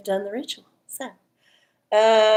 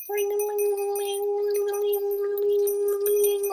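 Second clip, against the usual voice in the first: high, slow sing-song ring-a-ling; playful sung bell sound; bell imitation; 11 semitones higher than usual. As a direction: chime the words like a little bell.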